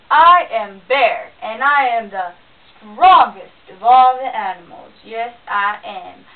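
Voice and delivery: big, loud, slow boastful bear voice; booming bear. A narrator's reading. Bear bragging to himself, booming loud. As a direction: puff up and boom like a proud bear.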